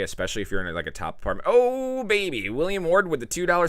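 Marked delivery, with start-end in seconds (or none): 1.5-3.7 very high, loud delighted exclamation; excited shout-out. Real, not acted. Streamer hyping a viewer's super chat, high and excited.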